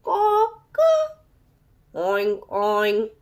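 crow, then snort like a pig; crow turning into oinks. Acted. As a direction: rooster oinking by mistake. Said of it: "So he tried again".

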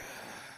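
long tired sigh; heavy sigh. Real, not acted. Streamer sighs at the broken audio, defeated.

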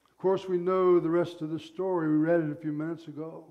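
ordinary talk

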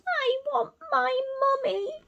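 very high tiny owl wail; littlest owl. Acted. Reading to children, baby owl Bill wails for mummy. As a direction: cry for mummy like the smallest baby. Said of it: "said Bill".

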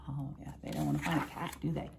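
ordinary delivery

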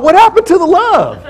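high, loud, laughing disbelief; indignant surprise. Retold or imitated. Preacher reacting to Jesus' rebuke, laughing high disbelief. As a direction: squeal it in mock outrage through a laugh.

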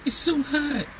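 high mock whine about the heat; hammed-up imitation of heat complainers; whiny complainer. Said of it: "for all y'all people that was".

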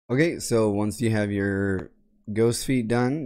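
ordinary talk

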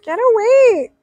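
disgusted yelp. Real, not acted. Reacting in disgust.

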